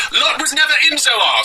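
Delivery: high mock-zealot proclamation; Yirmeyahu Weeps. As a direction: recite it as a high-pitched caricature of a zealot.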